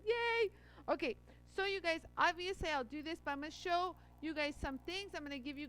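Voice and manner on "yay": high, loud little cheer; excited yay. Retold or imitated. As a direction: squeal with joy at the package. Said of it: "opened up the package and said".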